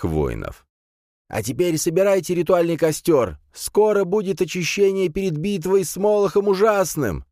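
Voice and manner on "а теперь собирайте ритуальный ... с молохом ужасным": high-pitched zealous priest; priest proclaiming. A narrator's reading. Priest orders a ritual bonfire, high proclamation. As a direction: announce it to the crowd in a high cry.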